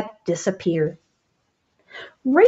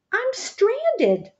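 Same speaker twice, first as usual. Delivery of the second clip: high fearful cry; 13 semitones higher than usual. A narrator's reading. Frog calls for rescue.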